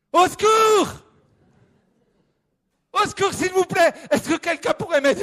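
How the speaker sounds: high, loud panicked cries breaking toward tears; terrified screaming. Acted. The hiker lost at night, sure he will die, screaming. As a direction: shriek in terror at the spiders.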